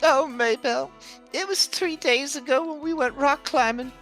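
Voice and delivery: high, upset husband voice; a worried, whiny husband pleading innocence. Acted. GM voicing NPC partner.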